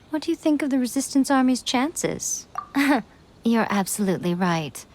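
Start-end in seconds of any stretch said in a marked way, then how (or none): 2.5-3.0 short knowing laugh. Acted. Amused by the traveler's answer.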